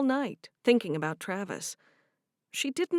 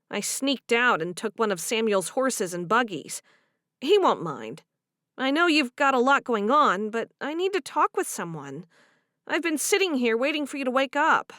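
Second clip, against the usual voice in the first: put-on troubled young wife; 3 semitones higher, 5 dB louder than usual. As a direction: pour it out to your friend. Voiced character dialogue.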